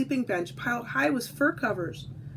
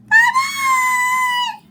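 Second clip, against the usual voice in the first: very high, loud squeaky shout; a tiny cub squeaking a farewell; 11 dB louder than usual. Acted. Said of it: "a high squeaky voice calling to her".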